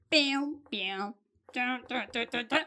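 little "piu" zaps, then rapid "tu-tu-tu"; playful sound effects. Shown as a demonstration. Teacher changing a verb ending, playful sound effects. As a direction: make small cartoon noises, low and short. Imitated noises while editing the word.

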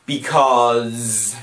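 long drawn-out word; stalling for an answer. Acted. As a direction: stretch it out, searching for a reason. Stalls over a plot explanation.

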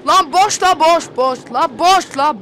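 loud slow repeated yell; shouting. Real, not acted. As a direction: yell it over and over. Yelling at jump scare.

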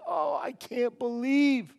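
hammed-up anguish, stretched slowly; mock weeping lament. Regretful man beating his chest, mock weeping. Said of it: "weeping, and saying".

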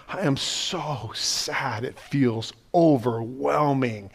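a broken, barely voiced lament; hushed sorrow. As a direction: let the words sink into quiet sorrow. Jesus voiced in his sorrow.

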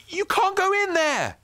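high, loud panicked yell. A narrator's reading. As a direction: yell to stop him. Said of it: "yelled Ben".

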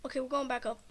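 ordinary talk

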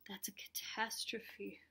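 slow, aghast whisper; horrified whisper. A narrator's reading. Spencer hears toys must go, whispering in horror. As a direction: whisper it as if disaster has struck.

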